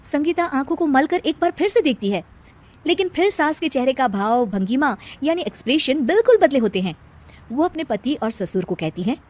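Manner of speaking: usual speaking voice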